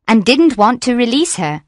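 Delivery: usual speaking voice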